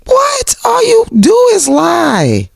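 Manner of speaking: very high, drawn-out squeal; mock incredulous outrage. Retorting to Martell's denial, incredulous squeal. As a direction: squeal it slowly in disbelief.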